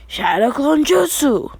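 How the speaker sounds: high, slow, put-on anime hero call; jutsu battle cry. Naruto casts clone for builder.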